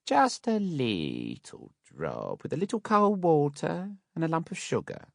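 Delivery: slow then very high sugary coax; coaxing Mrs Mann. A narrator's reading. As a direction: drawl it out temptingly.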